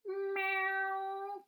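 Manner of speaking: high, sung cat meow. Shown as a demonstration. Teacher showing children how to sing like cats, high meow. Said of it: "sing it as if we were cats".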